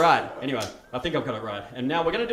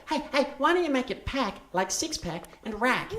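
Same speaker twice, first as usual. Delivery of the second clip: put-on young girl's suggestion; 10 semitones higher than usual. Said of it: "And this girl went".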